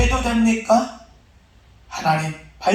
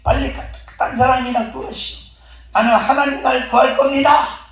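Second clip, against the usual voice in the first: insistent refusal; 5 semitones higher than usual. Acted. Man refuses rescue, insisting on God.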